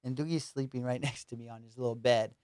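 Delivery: ordinary talk, nothing marked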